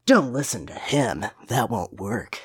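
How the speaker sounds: low, slow, unimpressed Shadow; Shadow, brooding. Acted. Co-host reads Shadow's line about crying Tails, low and brooding. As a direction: mutter it low and gruffly dismissive.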